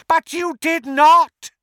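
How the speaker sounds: high, loud villain outburst; angry interruption. A narrator's reading. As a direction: cut in with a screamed objection. Voldemort interrupts Harry in anger.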